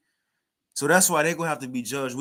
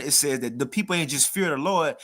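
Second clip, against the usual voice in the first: ordinary talk, nothing marked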